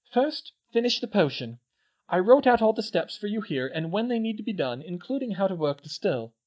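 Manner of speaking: put-on high girlish voice, proud of her work; a clever schoolgirl boasting; Hermione. A narrator's reading. Hermione explains her still to the twins, voiced high.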